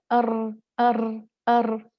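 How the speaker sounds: short rolled r sound, tongue tip fluttering; trilled r. Shown as a demonstration. Teacher demonstrating the trilled r for students. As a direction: roll the r briefly. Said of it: "इसको तकरार कहते हैं".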